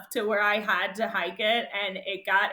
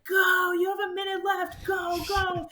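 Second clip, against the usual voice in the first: urgent shout; 9 semitones higher than usual. Retold or imitated. Race volunteer hurrying a late runner, high urgent shout. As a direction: yell at her to hurry before the cutoff.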